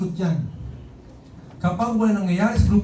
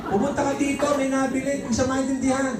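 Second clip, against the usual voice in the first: higher, softer calling voice; father calling; 7 semitones higher, 3 dB quieter than usual. Retold or imitated. Father calling the son to the store again, higher. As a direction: call the son once more. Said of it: "Sabi ko".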